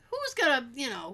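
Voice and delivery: ordinary talk, nothing marked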